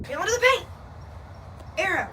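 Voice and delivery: high, loud callout; shouting. Real, not acted. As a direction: yell it at your teammate. Calling out in game.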